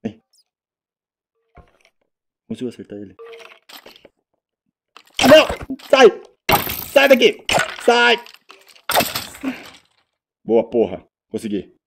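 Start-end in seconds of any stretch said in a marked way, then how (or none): 5.2-8.2 high, loud frantic shouts; panicked shouting. Real, not acted. Player fending off a monster, panicked yells. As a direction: shoo it off in a panic. Fighting off an attacker in game.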